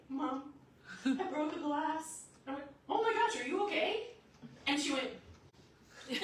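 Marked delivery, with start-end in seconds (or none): 0.1-2.2 higher, slow, nervous admission; shaking child's confession. Retold or imitated. Shaking daughter to mom after breaking a glass, nervous slow confession. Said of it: "she's shaking". 2.9-4.1 very high, loud motherly fussing; alarmed concern. Retold or imitated. Mom to shaking daughter who broke a glass, high alarmed concern. Mom's concerned reaction to confession.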